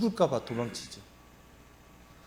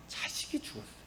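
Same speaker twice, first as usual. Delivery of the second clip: hushed whisper; 11 semitones higher, 12 dB quieter than usual. Acted. Pastor telling of the widow's dead son, in a whisper. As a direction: whisper it, grief-struck. Grieving mother's loss described.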